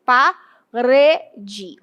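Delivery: "Parede" in slow syllables with a strong "re"; audible tonic accent. Shown as a demonstration. Teacher showing the accent heard in speech, syllabified "Parede". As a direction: sound out "Parede", pressing on the middle "re". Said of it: "na minha pronúncia ele aparece".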